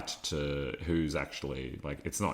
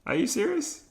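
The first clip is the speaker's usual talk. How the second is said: much higher, louder disbelief; incredulous; 5 dB louder than usual. Real, not acted. Host scoffing at people who blame everything on bad luck, high and incredulous. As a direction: sound like you can't believe your ears.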